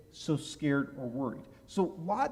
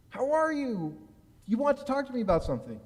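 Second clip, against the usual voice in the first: higher, fast, over-eager greeting; nervous eagerness; 9 semitones higher than usual. Retold or imitated. Young rabbi anxiously fishing his boss for news, eager and quick. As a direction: chirp it up like you're dying to know.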